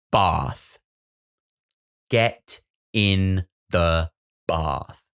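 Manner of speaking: slow, firm order; stern dad. Retold or imitated. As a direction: lay down the law slowly.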